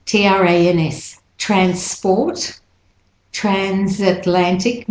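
normal talking voice

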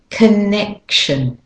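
connection slowly, drumbeat on NEC; stress on NEC. Shown as a demonstration. Teacher showing the drum beat, stress on NEC in connection. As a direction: land hard on the middle of connection. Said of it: "You can hear the drum goes dum on the neck".

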